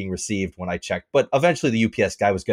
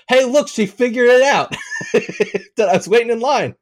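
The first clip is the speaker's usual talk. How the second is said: high, loud gloating announcement; retold loud jab, pitched right up; loud pointed call; 13 semitones higher, 5 dB louder than usual. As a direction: call it out so the other man hears. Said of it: "I said all that loud enough for him to hear".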